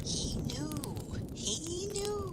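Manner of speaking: put-on high, hushed old crone voice; eerie knowing whisper. Old woman hushing Crane about his horse, high and eerie. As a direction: murmur it like a spooky secret.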